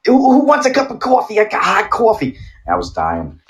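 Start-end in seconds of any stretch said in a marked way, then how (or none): 0.0-2.4 high-pitched, excited New Yorker impression; comic New York coffee seller's squeal; New Yorker accent. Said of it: "that New Yorker accent".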